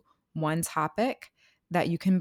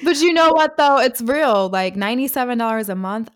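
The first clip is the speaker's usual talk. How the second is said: excited; 6 semitones higher, 10 dB louder than usual. Real, not acted. Excited sales pitch.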